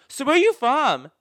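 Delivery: high, loud yell as in a noisy club; yelling over music. Retold or imitated. Shouting over club music, retold.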